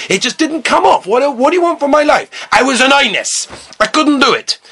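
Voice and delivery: mock exasperation, pitch pushed up; exasperated protest. Student stuck to pillow defends himself, exasperated. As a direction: plead innocence with rising exasperation.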